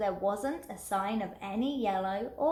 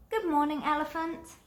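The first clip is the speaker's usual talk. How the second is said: animals' greeting; 6 semitones higher than usual. A narrator's reading. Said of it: "each one said to him".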